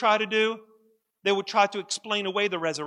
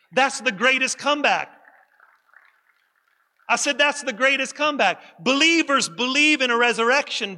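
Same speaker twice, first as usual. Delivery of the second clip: rising, emphatic pulpit delivery; preaching; 4 semitones higher, 3 dB louder than usual. Acted. Pastor urging his congregation to study the resurrection, preaching. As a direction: preach it to the congregation with growing force.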